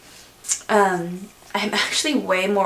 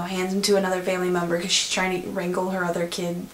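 ordinary talk

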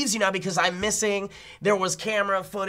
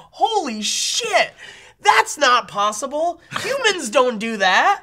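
louder, slow, drawn-out amazement; stunned disbelief; 5 semitones higher, 4 dB louder, slower than usual. Acted. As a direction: act out being floored, dragging each word. Acts out his stunned reaction.

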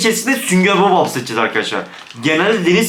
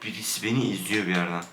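hushed, much softer, spooked; scared whisper; 10 semitones lower, 13 dB quieter than usual. Real, not acted. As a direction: murmur it as if someone may hear. Spooked in horror game. (korkmuş fısıltı)

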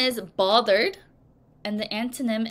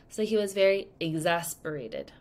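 usual speaking voice

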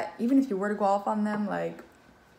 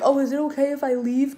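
higher and louder, mock timid asking; mock polite asking; 6 semitones higher, 7 dB louder than usual. Reader mocks asking the ex to leave, mock timid. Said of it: "you weren't like".

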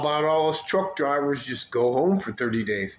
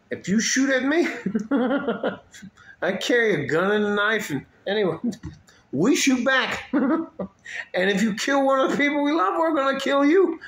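much higher, louder, laughing through the bravado; laughing threat; 11 semitones higher, 3 dB louder than usual. Real, not acted. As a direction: cackle while you brag about shooting back.

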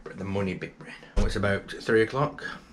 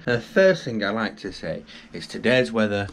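ordinary talk, nothing marked

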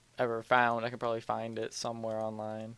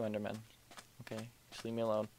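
ordinary talk

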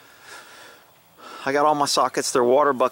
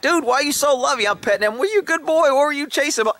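much higher, cooing at a pet; sweet-talking a cuddly dog; doggy talk; 12 semitones higher than usual. Retold or imitated. Petting the dog rubbing his head, cooing.

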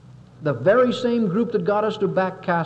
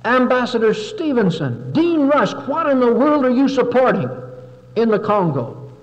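senator's speech; 4 semitones higher, 5 dB louder than usual. Retold or imitated. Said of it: "rose up in the Senate ... and said".

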